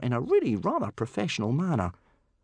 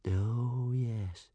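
ordinary delivery

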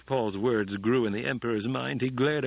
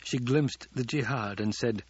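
ordinary delivery

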